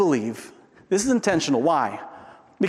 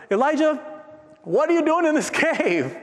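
God questioning Elijah; 12 semitones higher than usual. Acted. God to Elijah hiding in the cave, incredulous challenge. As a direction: call him out, pitched well up. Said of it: "and God says".

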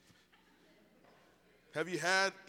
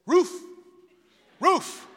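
short, barked "roof"; talking dog; 10 semitones higher, 11 dB louder than usual. Acted. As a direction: say it like a dog's bark. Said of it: "And the dog said".

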